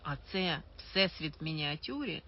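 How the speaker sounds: usual speaking voice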